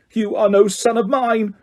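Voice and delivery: high, forceful disowning; father disowning his son; bellowed. A narrator's reading. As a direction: hurl the rejection. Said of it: "bellowed Mister Crouch".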